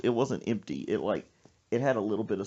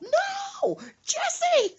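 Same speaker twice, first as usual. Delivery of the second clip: very high, loud scream; mock panicked mama; 6 dB louder than usual. Mother seeing doll threatened, high mock-panicked scream. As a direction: scream as if begging him to stop.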